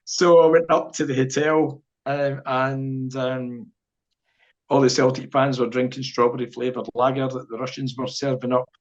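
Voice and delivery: usual speaking voice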